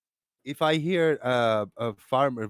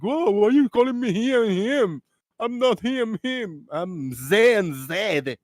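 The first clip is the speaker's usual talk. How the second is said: mock offended; 8 semitones higher, 4 dB louder than usual. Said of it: "coming offended like".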